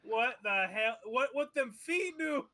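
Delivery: high, slow, stretched-out disbelief; shocked disbelief. Real, not acted. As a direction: gawk at it in horror. Shocked at the halfling's feet.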